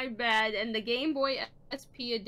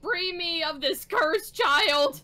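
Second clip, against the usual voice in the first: 1 mock scream; 4 semitones higher, 5 dB louder, slower than usual. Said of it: "I screamed at the top of my head".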